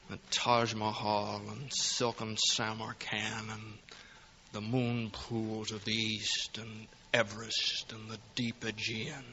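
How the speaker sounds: soft, slow, far-off dreamy recital; wistful dreaming. Acted. George dreaming aloud of places he'll never see, soft and slow. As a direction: drift away into the dream of travel.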